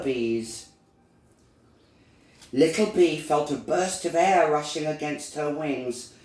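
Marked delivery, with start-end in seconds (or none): none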